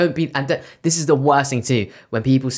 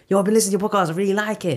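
higher-pitched mimicked listener; keen party guest; 7 semitones higher, faster than usual. Party guest praising the podcast, mimicked keenness. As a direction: mimic an eager compliment.

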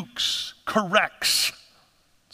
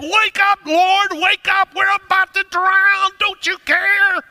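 shouting in panic, much higher and louder; panicked shout; 12 semitones higher, 7 dB louder, slower than usual. Retold or imitated. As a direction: scream him awake as the boat sinks. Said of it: "grabbing Jesus by the shoulders and shaking".

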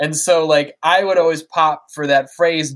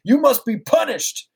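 mock Dark Man yell; 5 semitones higher than usual. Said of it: "he's like, yelling at Tim and Eric".

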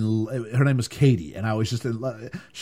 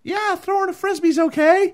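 much higher, mock mumbly kid reply; sullen kid; 4 dB louder than usual. Teen answering stepdad's question, mock bored high voice. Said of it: "you'd say things like".

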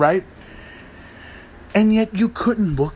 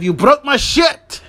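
mock angry shout; 6 semitones higher, 3 dB louder than usual. Rusev gets upset.

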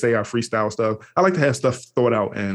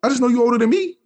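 much higher, earnest questioning voice; eager young self; 13 semitones higher, 3 dB louder than usual. Retold or imitated. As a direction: play yourself as an eager kid asking if he's your uncle. Young self questioning older man.

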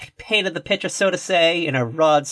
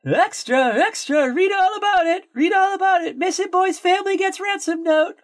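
put-on old-time paperboy; 14 semitones higher than usual. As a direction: holler the headline on a street corner. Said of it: "ready for some old-timey reenactments".

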